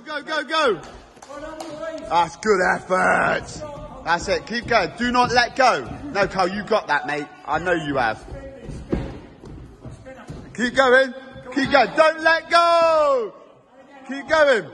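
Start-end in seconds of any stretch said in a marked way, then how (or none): 0.0-0.8 high cheering burst; excited cheering. Real, not acted. Cheering Carl from the side, high excited whoops. 10.5-14.7 high, urgent, stretched shout on "let go"; urgent cheering. Real, not acted. Urging Carl to hold on, high urgent shouting.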